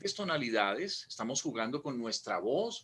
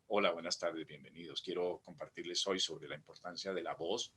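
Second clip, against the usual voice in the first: mock low monotone; 6 semitones lower, 5 dB quieter than usual. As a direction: lower and softer, sleepy. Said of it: "siempre utilizar el tono abajo".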